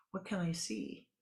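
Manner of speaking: ordinary talk, nothing marked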